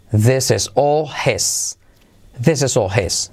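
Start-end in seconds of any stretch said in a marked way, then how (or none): 0.1-1.8 slow model sentence. Shown as a demonstration. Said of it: "Esto es todo de él".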